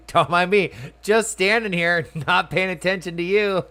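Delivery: high, loud, laughing through the words; laughing taunt. Real, not acted. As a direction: tease him while cracking up. Taunting, laughing while hiding.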